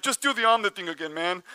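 fast, dismissive crowd voice; nervous crowd dodge. Retold or imitated. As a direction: wave him off, quickly. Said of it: "They're like".

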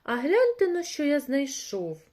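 high, loud call to come and look; rooster calling out. A narrator's reading. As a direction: call them over to see a find. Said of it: "став гукати півник".

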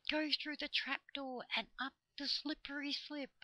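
high, airy voice of talking leaves; leafy voices. A narrator's reading. Trees advise the children, in high leafy voices. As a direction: speak as rustling foliage, pitched high. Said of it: "in their leafy voices".

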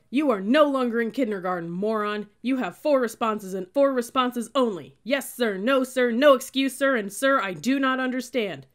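loud, high, angry dressing-down; snarling. A narrator's reading. Cadet lunging at a recruit, snarling loudly. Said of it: "lunged toward him and snarled".

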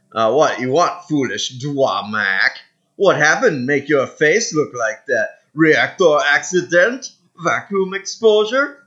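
put-on taunting alien in a loose Mediterranean accent; mock Mediterranean-Caribbean alien accent. Gamer as alien mocking a foreigner's face, put-on Mediterranean accent. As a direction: jeer with a put-on, faintly Caribbean lilt. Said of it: "why I gave him that accent".

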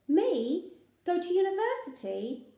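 high, rising disbelief; can't believe what she's hearing; astonished. A narrator's reading. A daughter stunned by her father's hopes, rising disbelief. Lil astonished at Dad's idea.

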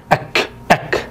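whispered أك, quick and short; أك whispered, quick and clipped; whispered syllable. Shown as a demonstration. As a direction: breathe أك out fast. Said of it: "خاطف وسريع".

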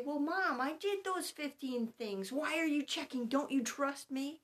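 mock-offended child asking if mom trusts them; protesting child. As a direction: play the kid pushing back on mom's check. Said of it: "And they say".

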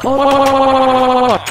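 loud startled cry; sudden loud scream of alarm; alarmed yell. Real, not acted. Earthquake hits, speaker cries out.

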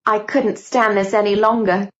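ordinary delivery, nothing marked

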